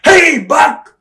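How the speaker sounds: high, loud friendly hail; Vader calling out. Retold or imitated. Vader yelling to Buck from his car, high loud mock greeting. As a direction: call out across the parking lot like an old pal. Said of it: "and he goes".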